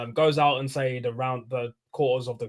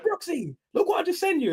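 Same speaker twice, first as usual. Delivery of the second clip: excited call re-enacted. Fan phoning his friend Brooksy about the signing, high excited squeal. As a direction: squeak the news excitedly to your mate.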